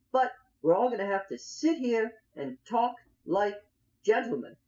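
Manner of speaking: high, insistent negotiator voice; bargaining camp leader. Acted. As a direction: plead the deal with a blade at the neck. Plays Hezekiah bargaining at swordpoint.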